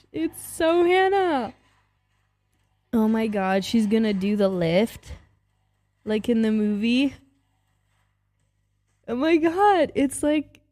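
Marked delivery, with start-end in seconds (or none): 0.1-1.5 high, slow, drawn-out fangirl squeal; higher and slower, melting over it; delighted squeal. Real, not acted. Squealing at the outfit. 9.1-10.6 excited gasp. Real, not acted. Overwhelmed by nostalgia.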